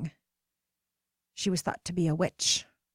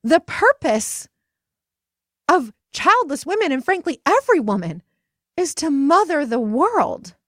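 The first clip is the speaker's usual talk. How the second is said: passionate proclamation; 9 semitones higher, 8 dB louder than usual. Retold or imitated. Friend declaring every woman's purpose, loud and fervent. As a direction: proclaim it like a rallying cry. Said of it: "she just went on and said".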